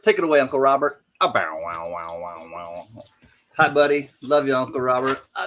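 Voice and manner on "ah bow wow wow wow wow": deep, fading bow-wow bark; mock dog barking. Host greeting Uncle Robert with a mock dog bark. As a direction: bark like a dog, dropping away to nothing.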